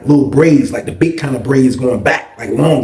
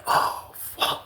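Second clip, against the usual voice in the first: breath-only "uh-oh" reaction; whispered dismay; 13 dB quieter than usual. Retold or imitated. Teller realizing the tattoo is ruined, whispered dismay. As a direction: whisper it as it sinks in.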